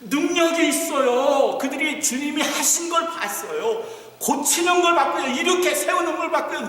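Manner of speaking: fervent pulpit climax; impassioned preaching. Acted. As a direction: preach it with rising conviction. Preaching rises to full force.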